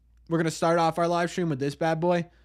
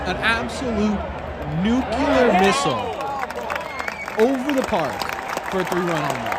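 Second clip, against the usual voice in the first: louder, higher excited play-by-play call of a homer; home run call; 5 semitones higher, 4 dB louder than usual. Retold or imitated. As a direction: belt it out like a broadcaster calling the blast. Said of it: "Here's what we could not watch".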